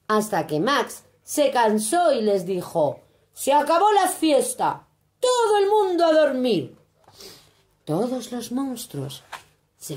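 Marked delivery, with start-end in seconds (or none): 3.3-6.8 mando de niño, agudo y firme; niño mandón. A narrator's reading. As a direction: ordena como un pequeño rey. Max termina la fiesta. (Max manda a dormir, agudo)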